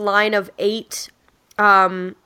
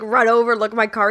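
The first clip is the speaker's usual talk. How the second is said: ordinary talk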